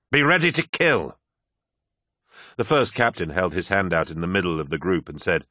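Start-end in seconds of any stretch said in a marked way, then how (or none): 0.1-1.2 high, fierce urging; rally your comrade for battle; rousing command. Acted. Abaddon rallies Loken before battle.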